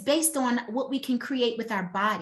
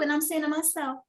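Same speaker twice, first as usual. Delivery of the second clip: ordinary talk, nothing marked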